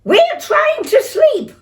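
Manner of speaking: very high, loud annoyed shout; irritated neighbours shouting; angry yell. Acted. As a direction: yell from next door. Neighbours yelling.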